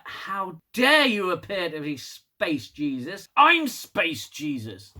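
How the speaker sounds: slow, drawn-out indignant declaration; mock outrage. Burnham challenging a rival Space Jesus, mock outrage.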